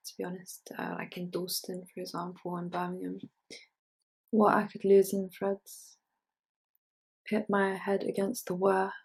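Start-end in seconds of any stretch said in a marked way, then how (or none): 4.3-8.9 measured poetry recital, firmer than chat; poem reading. Acted. Poet reading a pickling poem to an audience, measured recital. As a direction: read it out in deliberate phrases with pauses.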